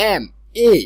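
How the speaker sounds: "এম" said as a letter name, then "এ" alone; sounded-out first vowel. Shown as a demonstration. Teacher showing the letter M starts with a vowel, sounded out. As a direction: say "এম", pause, isolate its opening "এ". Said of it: "এ, এ যে vowel সাউন্ডটা".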